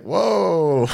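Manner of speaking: a loud, high startled whoa; mock surprise. Coworker reacting to insult, loud startled whoa. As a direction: play the coworker, taken aback. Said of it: "and he's like".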